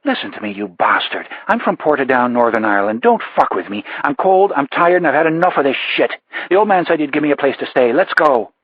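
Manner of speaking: put-on a cornered Portadown woman laying down the law in her Northern Irish accent. Rebecca to Delaroche, snapping in a Northern Irish accent.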